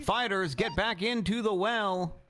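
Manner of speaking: live ringside commentary, running call of every punch; fight play-by-play. Acted. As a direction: call the fight blow by blow like a sportscaster. Live play-by-play of round two.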